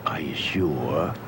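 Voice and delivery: slow unvoiced whisper; hushed doubtful whisper. Doubtful aside to judge.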